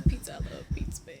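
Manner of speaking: soft unvoiced whisper. Real, not acted. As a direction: whisper it like a secret. Joking whisper about pizza.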